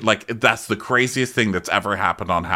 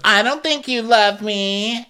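mock Kenya voice; 12 semitones higher, slower than usual. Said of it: "And Kenya goes".

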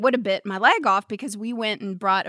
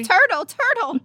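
put-on excited little kid; 9 semitones higher, 3 dB louder than usual. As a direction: echo the toddler's squeal. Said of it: "echoing the kid line".

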